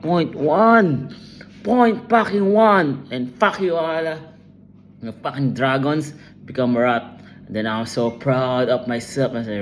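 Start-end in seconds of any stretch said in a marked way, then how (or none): 0.0-4.2 frustrated. Real, not acted. Gamer raging at result.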